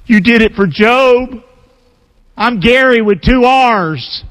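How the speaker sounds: high, slow teasing tone; playful aside. Acted. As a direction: tease God with a grin. Joking prayer to God.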